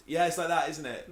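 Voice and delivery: ordinary talk, nothing marked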